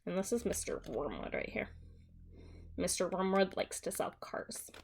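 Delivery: usual speaking voice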